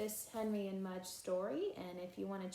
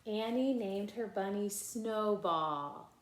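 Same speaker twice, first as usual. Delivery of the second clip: put-on lively read-aloud voice for children; animated storybook reading with character lines; story narration; 8 dB louder, slower than usual. A narrator's reading. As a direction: read it like a bedtime picture book. Reading picture book aloud.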